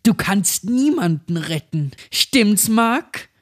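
rising, high mocking child voice; taunting possessed girl. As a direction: taunt him like a demon child. Possessed girl mocks Mark. (höhnisches besessenes Mädchen)

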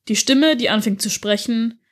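ordinary delivery

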